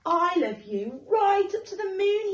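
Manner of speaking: high, loud final boast; little hare's winning claim; triumphant little hare. Acted. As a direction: throw it up to the moon. Sleepy little hare's last boast.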